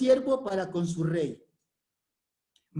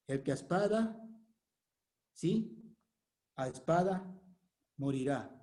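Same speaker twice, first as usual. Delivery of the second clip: solemn quiet; 5 semitones lower, 8 dB quieter than usual. Acted. Solemn quote of Jesus.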